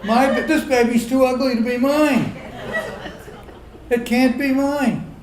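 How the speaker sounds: loud, high mock panic yell at the nurses; mock screaming. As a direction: yell in hammed-up outrage about the ugly baby. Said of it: "I go screaming".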